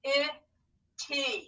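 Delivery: slow, syllable by syllable; scooping the word in parts; scooped syllables. Shown as a demonstration. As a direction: sound out each syllable slowly. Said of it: "scoop and read along with me".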